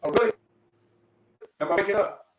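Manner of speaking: ordinary delivery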